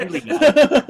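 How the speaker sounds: lightly sketch an odd spirit voice; ghost voice. Retold or imitated. Voice actor simulating the ghost recording, high and loud. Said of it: "so I'll try to simulate it".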